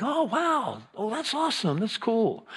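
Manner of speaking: high but soft oh wow; mildly impressed. Shown as a demonstration. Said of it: "goes up a little more when something cool happens".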